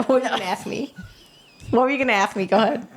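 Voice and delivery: ordinary talk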